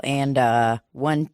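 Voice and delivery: ordinary talk, nothing marked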